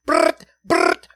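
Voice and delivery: loud gunfire burst sound; imitated gunfire. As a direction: rattle it like a machine gun.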